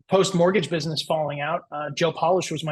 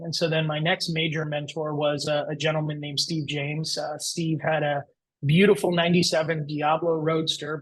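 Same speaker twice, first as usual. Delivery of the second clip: usual speaking voice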